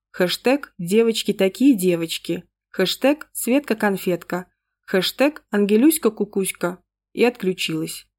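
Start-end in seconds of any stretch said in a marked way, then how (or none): none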